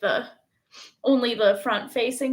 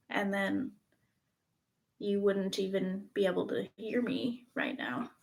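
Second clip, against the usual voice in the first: whispering; 3 semitones lower, 7 dB quieter than usual. Pretend. Joking about cutting out.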